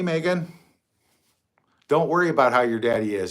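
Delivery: ordinary talk, nothing marked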